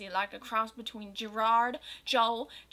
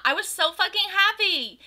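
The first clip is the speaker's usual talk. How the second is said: much higher and louder, gushing; thrilled; 8 semitones higher, 7 dB louder than usual. A narrator's reading. Reader narrating the concert, gushing and excited. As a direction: burst with fangirl glee.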